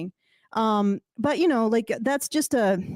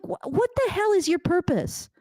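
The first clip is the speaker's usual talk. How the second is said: exasperated; 7 semitones higher than usual. Retold or imitated. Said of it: "I'm like".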